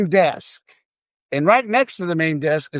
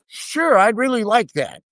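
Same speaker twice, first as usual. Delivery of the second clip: put-on pitched-up, eager young hopeful; hesitate, then accept eagerly in a higher voice; eager acceptance; 6 semitones higher than usual. Young clerk accepts a casino job offer, eager.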